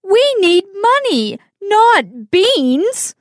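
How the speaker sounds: high, sharp scolding mother; angry mother. Acted. Mother scolding Jack for trading the cow, angry. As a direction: snap at Jack over the beans. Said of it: "She is very angry".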